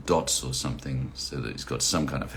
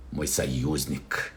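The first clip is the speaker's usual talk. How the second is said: normal talking voice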